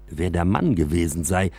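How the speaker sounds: plain reading voice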